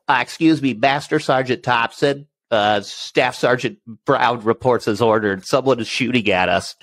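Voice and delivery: mock parade-ground formality while under fire; stiff formal soldier.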